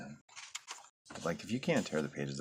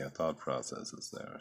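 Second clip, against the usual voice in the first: ordinary talk, nothing marked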